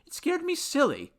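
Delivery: high, nervous confession; high-pitched jitters; babbling. A narrator's reading. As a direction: blurt it out in a high, rattled voice. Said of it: "babbled Henry".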